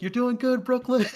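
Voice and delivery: high, loud sideline cheer; encouraging cheer. Retold or imitated. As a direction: imitate an over-eager parent cheering a kid on. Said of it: "trying to encourage her".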